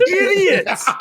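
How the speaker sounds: high-pitched yell of exasperation; comic outburst, laughing chaos around it; exasperated shout. Acted. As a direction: blow up at the whole group. Host loses patience with the group.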